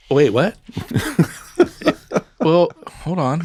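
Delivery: laughing through a high wait, what; laughing disbelief. Real, not acted. Host laughs at the soup complaint, amused. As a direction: crack up at the complaint.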